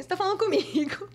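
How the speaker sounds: high, incredulous question; mock indignant challenge. Model answering back to the shouting producer, indignant high question. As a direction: retell a challenge with rising disbelief.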